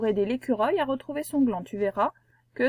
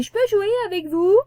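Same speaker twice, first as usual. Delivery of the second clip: put-on high, piping childlike voice; sweet small voice begging to play; little letter E asking; 9 semitones higher, 4 dB louder than usual. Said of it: "ce E là qui arrive et qui dit".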